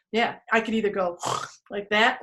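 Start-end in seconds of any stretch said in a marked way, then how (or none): none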